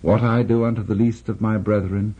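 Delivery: normal talking voice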